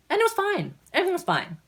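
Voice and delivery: high-pitched insistence, falling at the end; insistent reassurance. Retold or imitated. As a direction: jump up high as if protesting. Retelling her uneventful earlier printing attempt.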